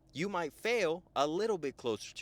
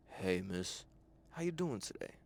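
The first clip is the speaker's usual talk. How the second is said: low, softer, smooth greeting; lowered voice; 8 semitones lower, 6 dB quieter than usual. Shown as a demonstration. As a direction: drop your voice low and cool. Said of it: "I'm gonna lower it down".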